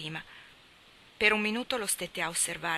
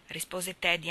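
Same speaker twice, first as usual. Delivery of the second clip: ordinary delivery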